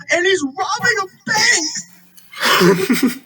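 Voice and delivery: high, slow, laughing exclaim. Real, not acted. Fan describing an absurd movie clip, laughing high exclaim. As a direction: crack up while saying it.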